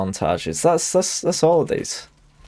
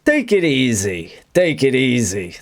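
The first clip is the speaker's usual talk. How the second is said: higher, slow, drawn-out mob boss calming someone down; a slow, raised Tony Soprano "take it easy"; 3 semitones higher than usual. Retold or imitated. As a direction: play the big mob boss telling someone to chill. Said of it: "if it was Tony Soprano".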